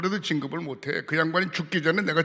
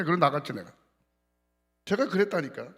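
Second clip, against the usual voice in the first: usual speaking voice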